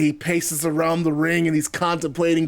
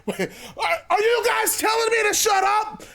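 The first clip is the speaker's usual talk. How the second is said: high-pitched disbelieving wrestler; incredulous Jericho; 14 semitones higher than usual. Acted. Wrestler asks chanting crowd who they mean, high and incredulous.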